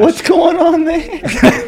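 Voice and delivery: surprised. Real, not acted. Lighter suddenly catches fire.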